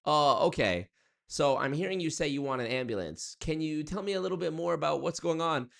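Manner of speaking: a hint of a bland AI voice; mock AI operator stalling a caller; calm chatbot operator. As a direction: read it like a scripted helper. AI operator voice again.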